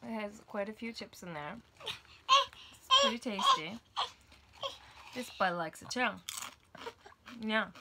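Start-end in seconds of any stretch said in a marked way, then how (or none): 1.9-3.8 slow squeaky cartoon voice; drawn-out high silly voice.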